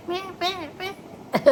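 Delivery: high, thin squeak of a lost voice; mock squeaky voice. Wife mimicking husband's hoarse cold-struck voice, high squeaks. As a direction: squeak it out like a choked-up throat. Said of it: "ऐसे चल रही है".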